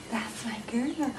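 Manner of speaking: whispered praise; whispering. Real, not acted. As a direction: breathe the praise softly, no voice. Praising dog softly.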